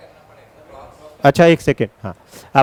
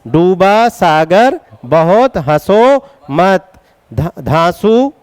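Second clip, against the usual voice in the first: slow mnemonic recital; much slower than usual. Shown as a demonstration. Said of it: "याद रहेगा?".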